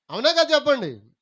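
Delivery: loud, high call for an answer; loud demand. Real, not acted. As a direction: press the congregation to respond. Repeats question, demanding louder response. (గట్టిగా గొంతెత్తి జవాబు అడుగుతూ)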